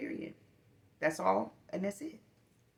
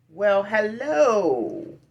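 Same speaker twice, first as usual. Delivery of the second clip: sweet grocery clerk greeting; 3 semitones higher, 8 dB louder, much slower than usual. Retold or imitated. Imitating the Food Lion guy's slow, sing-song hello. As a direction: greet her like the kindest man in the store. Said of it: "He's like".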